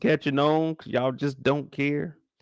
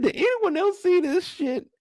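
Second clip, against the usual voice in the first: retold disbelief. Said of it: "I said".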